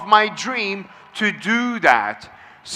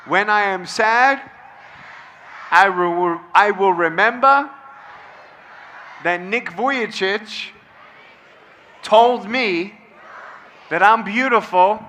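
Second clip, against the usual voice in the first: call and response affirmation. Shown as a demonstration. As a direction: lead the room through a pledge, phrase by phrase. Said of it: "Repeat after me".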